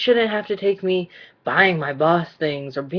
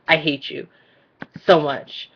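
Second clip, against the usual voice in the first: usual speaking voice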